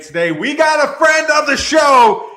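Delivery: loud, punchy fight-night announcer read; announcer hype intro. Acted. Host welcoming fight fans, booming announcer hype. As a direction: hype the crowd like a ring announcer.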